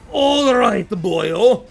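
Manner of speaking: loud put-on voice, starting high; bad mercenary impression. Streamer doing rapid-fire impressions, loud character bellow. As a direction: bellow it in character, dropping in pitch. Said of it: "Here are my shitty impressions".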